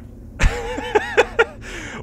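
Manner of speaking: very high laughter; shrieking laugh. Real, not acted. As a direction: burst into a shrill laugh. Laughing at teammate.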